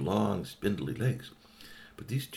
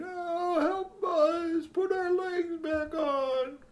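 begging robot dog; much slower than usual. A narrator's reading.

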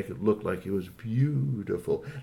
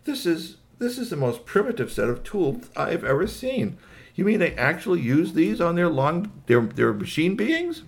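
high-pitched alien disbelief; incredulous space visitor, voice pitched up; appalled alien; 7 semitones higher than usual. Acted. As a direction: marvel in dismay at such crude gadgets. Alien appalled at primitive tools.

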